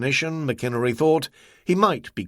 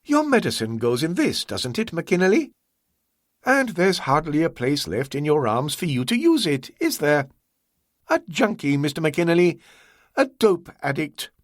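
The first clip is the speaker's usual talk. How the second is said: higher, courteous voice of the host; Ah Wong, polite host; 7 semitones higher than usual. A narrator's reading. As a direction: play the composed, obliging host, pitched up.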